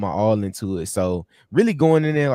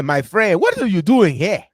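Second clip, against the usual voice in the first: put-on playful Nigerian accent, voice pitched up and loud; 8 semitones higher, 6 dB louder than usual. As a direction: greet him like a Nigerian uncle in Houston. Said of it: "you do a good Nigerian voice".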